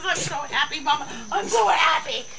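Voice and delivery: high, fast happy pup voice; dog's happy voice. Pretend. Voicing the dog thrilled with its pork, high and fast. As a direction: speak as the overjoyed dog. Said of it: "He says".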